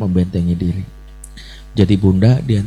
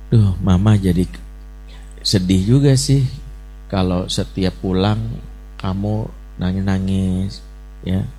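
gentle I message; 2 semitones lower than usual. Retold or imitated. Mother to her crying child, gently voicing her sadness. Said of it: "I message itu rumusnya apa?".

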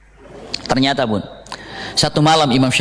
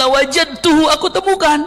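high, bright eureka; eager discovery; 11 semitones higher than usual. Acted. The Imam telling his teacher he found the sin, excited. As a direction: announce the find with joy.

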